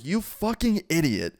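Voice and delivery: retold anger. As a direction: re-enact outrage, stretching the insult. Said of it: "I was so mad".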